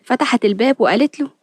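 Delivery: plain reading voice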